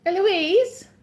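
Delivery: loud, high call up the stairs. Real, not acted. Calling to child upstairs.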